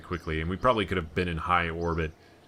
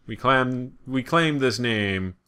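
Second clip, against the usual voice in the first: mock proclamation; 6 semitones higher, 5 dB louder, slower than usual. Mock proclamation planting flag.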